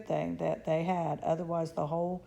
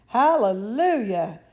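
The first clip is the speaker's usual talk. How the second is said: loud slow joyful yell; a hammed-up slow hallelujah; 4 semitones higher, 11 dB louder, slower than usual. A narrator's reading. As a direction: stretch it out in joy. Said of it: "yelled Grandpa Joe".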